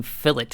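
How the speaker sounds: "fillet" with a sounded final t; British fillet. Retold or imitated. Mocking how British people say it, "fillet" as FILL-it. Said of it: "British people saying fillet".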